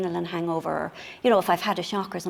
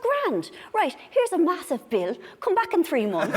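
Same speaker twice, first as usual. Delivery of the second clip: put-on brisk young doctor; 7 semitones higher than usual. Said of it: "He goes".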